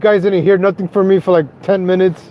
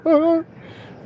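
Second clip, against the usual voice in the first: short high laugh; 10 semitones higher, 6 dB quieter than usual. Real, not acted. Laughs at own impression.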